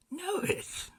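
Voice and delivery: startled recognition; high amazed exclaim; amazed Scrooge. A narrator's reading.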